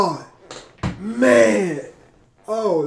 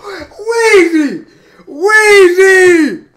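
loud overjoyed yell of a fan; hype shout; 5 semitones higher, 8 dB louder, much slower than usual. Real, not acted. Fan shouting at the song in real delight, loud and overjoyed. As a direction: scream his name like the verse just blew your mind. Fan hyped by [name] verse.

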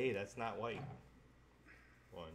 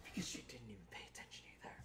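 whispering; 7 dB quieter, faster than usual. Real, not acted. Complaining aside.

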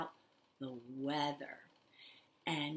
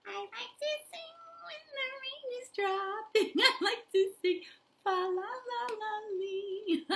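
put-on very high babyish singing; 4 dB louder than usual. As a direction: sing like a tiny baby. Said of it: "do the raindrops like a baby".

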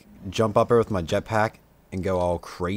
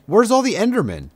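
much higher, louder puzzled outcry; real startled gamer shout; surprised call; 12 semitones higher, 6 dB louder than usual. Real, not acted. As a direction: call out the question in disbelief. Surprised, searching for mobs.

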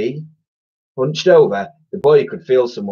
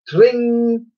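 high, ringing door bell; long high trill; bell imitation; 12 semitones higher, 3 dB louder than usual. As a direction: ring out like a shop bell. Imitating shop bell in story.

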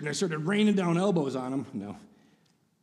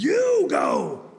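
higher, louder shouted you go; mock yell; 10 semitones higher, 6 dB louder than usual. The other driver points, shouting at the dad. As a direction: bark the order at him.

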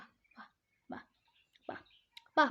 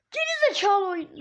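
angry yell; 3 semitones higher, 7 dB louder than usual. Real, not acted. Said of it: "Lan bağırmak istemiyorum".

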